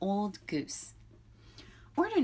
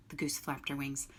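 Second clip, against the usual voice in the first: ordinary delivery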